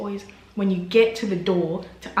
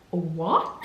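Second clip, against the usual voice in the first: surprised; 2 semitones lower than usual. Retold or imitated. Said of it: "I blew myself away".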